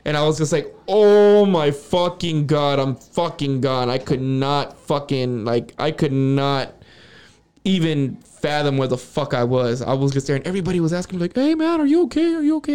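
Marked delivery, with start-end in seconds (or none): 0.9-3.9 long, drawn-out stoned realization; stoned amazement. Retold or imitated. Acting out being extremely high. 11.3-12.7 high-pitched imitation of checking on someone; mock-worried, high-voiced friend; concerned friends imitated.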